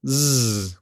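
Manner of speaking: a steady buzzing zzz; isolated voiced "Zzz", a continuous buzz; voiced z sound. Shown as a demonstration. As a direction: hum "Zzz" with the voice on, tongue tip near the ridge. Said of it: "Something like this".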